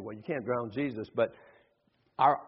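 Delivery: ordinary delivery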